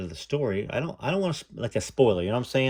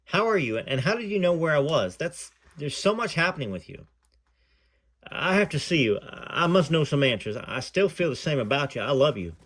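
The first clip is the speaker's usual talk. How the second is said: reading a memoir aloud, lightly voicing the callers; story narration; 4 semitones higher than usual. A narrator's reading. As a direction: read it like telling the story to an audience. Reads memoir aloud to viewers.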